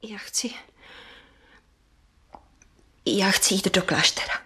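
barely whispered, halting; hushed confession. Acted. Liza admits to her aunt she wants the convent, whispered. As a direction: let the secret slip out on a breath.

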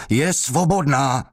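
high, strained desperate shout. Acted. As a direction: scream it out under the blows. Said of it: "křičel".

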